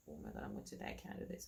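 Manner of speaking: soft, fading hopeless murmur; quiet retold despair mid-contraction. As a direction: breathe it out as if giving up.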